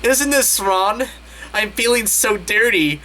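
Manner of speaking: mock moaning. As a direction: ham up the guilty moan.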